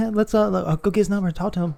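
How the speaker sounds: put-on high, quick giggly girl chatter; hushed-excitement giggle voice, high and hurried; giggling young girls. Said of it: "is where they're giggling".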